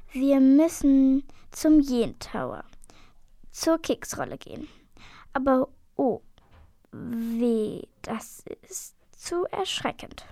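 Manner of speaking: hammed-up ominous book reading; dramatic reading. A narrator's reading. Fuchs liest aus dem Buch, theatralisch düster. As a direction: read it like a doom prophecy.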